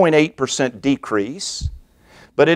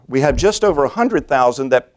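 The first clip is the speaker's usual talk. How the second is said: ordinary delivery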